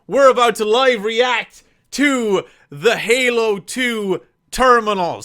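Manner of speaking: ordinary talk, nothing marked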